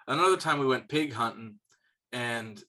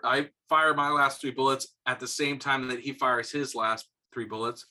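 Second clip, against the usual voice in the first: ordinary talk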